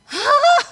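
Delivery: loud, high shocked cry; retold startled scream. Husband sees wife's new hair, high startled scream. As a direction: imitate a husband shrieking in shock. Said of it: "dia teriak".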